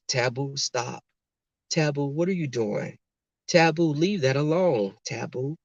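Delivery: soft, ineffectual dog correction; talking as to a human. Retold or imitated. Ex mildly telling the schnauzer off, human tone. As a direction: gently plead with the dog, imitating someone. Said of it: "like you're talking to a human".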